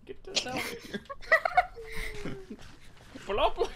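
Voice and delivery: breaking into laughter mid-line; giggling through the words; laughing. Acted. Blublin refusing child, laughing.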